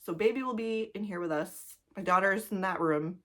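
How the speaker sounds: ordinary delivery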